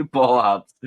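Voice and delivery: amused, breaking into laughter; laughing. Real, not acted. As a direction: echo it through a laugh. Laughing together at hop name.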